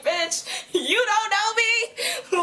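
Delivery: high, slow, laughing comeback; laughing sassy retort to Isabel; laughing mock retort. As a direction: cackle through a sassy clapback. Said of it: "And I was like".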